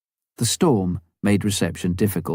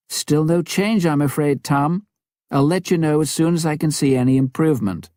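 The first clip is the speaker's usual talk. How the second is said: higher, brisk radio reply; lightly voiced woman reporting, a bit quick; radio operator answering the pilot, lightly voiced woman; Norma on the radio; 8 semitones higher, 3 dB louder than usual. A narrator's reading.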